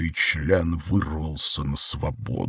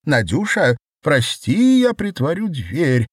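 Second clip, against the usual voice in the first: loud, high-pitched male character; high vibrating voice; 8 semitones higher, 6 dB louder than usual. A narrator's reading. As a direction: apologise in a quavering high pitch. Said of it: "высоким вибрирующим голосом".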